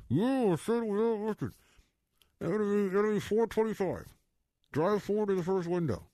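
high, tinny order-window voice; drive thru speaker. Retold or imitated. Imitating drive-thru worker.

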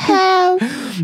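high faint echo of help; thin high cry. Retold or imitated. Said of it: "co-host echoing the voice". (eco fininho e agudo)

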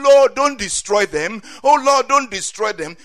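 loud, high-pitched plea; crying out. Acted. Moses begging God to spare the people, crying out. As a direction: plead urgently at full voice. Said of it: "Moses crying out to God for them".

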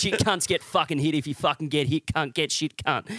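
mock bogan schoolmate rant; exaggerated Australian Facebook tough talk; broad Australian accent. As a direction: rattle off swearing like an Aussie lout. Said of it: "think of the Australian accent".

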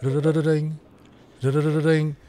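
rolled trill ending in a ding; imitated ringtone. Host starting the pretend client call, trilled ringtone. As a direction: sing out a fluttering phone ring.

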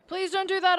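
high, loud, pleading cry; desperate plea. Acted. Pleading with friend not to approach woman, loud and high. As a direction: beg her not to go, voice shooting up.

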